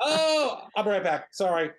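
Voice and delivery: imitating the actor caught out, hurrying off; sheepish actor. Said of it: "He goes".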